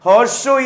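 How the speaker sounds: "horseshoe" with one sh sound, no separate s; assimilated pronunciation. Shown as a demonstration. Teacher showing assimilation to students, assimilated "horseshoe". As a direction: say "horseshoe" with the s turned into sh. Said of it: "correctly pronounced as".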